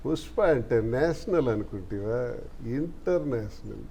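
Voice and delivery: half-hearted film-hero dialogue, soft and drawn out; underplay the movie catchphrase, quietly trailing down; Pushpa. Acted. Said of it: "Pushpa సినిమాలో dialogue చెప్తారా?".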